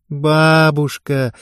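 drawn-out, slow, happy Бабушка; joyful drawn-out call. A narrator's reading. Eva calling her grandmother, drawing the word out joyfully. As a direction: stretch the word gladly. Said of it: "радостно протянула Ева".

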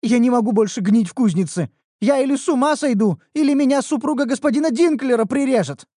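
put-on high, loud complaining girl; whiny young elf.

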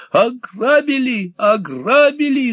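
put-on high, slow panicked shriek of an old miser. A narrator's reading. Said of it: "завизжал Артур Грайт".

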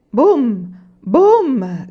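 booming, high, drawn-out "boom"; mocking shout. A narrator's reading. Man at the press jeering the boaster, shouting. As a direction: holler it like a cannon shot. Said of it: "gridò un uomo".

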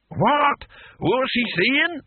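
put-on a half-deaf old fighter demanding to hear; deaf old man shouting. Deaf old barbarian asking what Rincewind said, in a high querulous shout.

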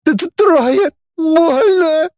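stammering, very high wail; tearful falsetto squeal; pained howl. A narrator's reading. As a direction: stutter and wail through the pain. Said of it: "взвыл лже-Фанас".

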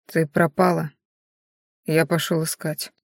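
drained, toneless delivery with long pauses; emotionless. A narrator's reading. Viedash explains his search to Rena, emotionless. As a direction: say it with all feeling gone. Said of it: "Голос Виедаши был полностью лишён эмоций".

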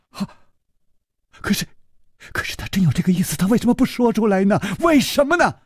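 distressed servant. Acted. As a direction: stumble over the words, upset. Yijian protests quickly.

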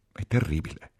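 mildly tearful lament; sobbing. A narrator's reading. Ferguson weeps over the situation, mild sob. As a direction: say it through tears, understated. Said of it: "singhiozzò la Ferguson".

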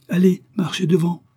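high, loud command; barked order. A narrator's reading. Commissioner to the frightened guard, barking an order. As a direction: order him briskly forward.

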